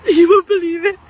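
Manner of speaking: half laugh, half sob of disbelief; crying laugh. Real, not acted. To the viewers about the robin, crying laugh. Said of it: "I can't stop shaking".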